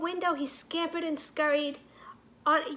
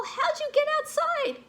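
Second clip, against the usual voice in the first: high, surprised, teasing voice; surprised zookeeper; 12 semitones higher than usual. Acted. The zookeeper spots Milo outside, high surprised call. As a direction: sound amazed at the little rascal.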